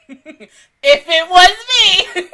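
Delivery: loud, high and drawn out through laughter; laughing outburst. Real, not acted. Imagining herself facing nosy coworkers, loud laughing outburst. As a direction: cry it out slowly while laughing. Laughing repeat of the same words.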